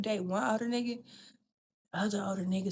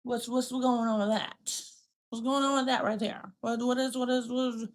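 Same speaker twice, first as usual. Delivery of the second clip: pointed questioning; 3 semitones higher, 4 dB louder than usual. Retold or imitated. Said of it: "they would make it real clear".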